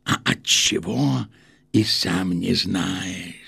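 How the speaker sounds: slow, hushed, fearful words; frightened whisper. Acted. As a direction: breathe it out in dread. Frightened official after scene.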